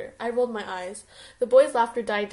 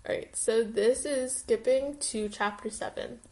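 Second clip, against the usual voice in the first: usual speaking voice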